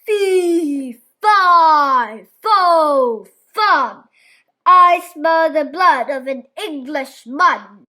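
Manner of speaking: loud, booming angry giant chant. A narrator's reading. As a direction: boom it out like a giant. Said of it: "The giant's voice boomed angrily".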